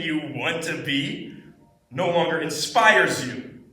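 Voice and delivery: intense, angry dramatic monologue with swelling peaks. Acted. Student performing a monologue for class feedback, intense. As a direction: deliver it intensely, pausing between bitter thoughts. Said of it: "This was a really intense monologue".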